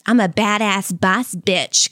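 slow, cocky boss-bitch voice; tough-talk swagger. Retold or imitated. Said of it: "I don't propose being like".

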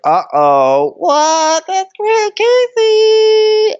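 very high, loud comic squeal; hammed-up panicky chorus; mock squealed alarm. Guests react to drunk guy naming Casey, mock squeal.

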